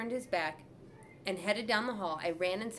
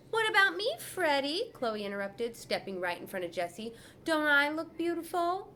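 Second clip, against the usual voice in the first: put-on attention-seeking girl; 7 semitones higher than usual. A narrator's reading. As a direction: demand to be admired.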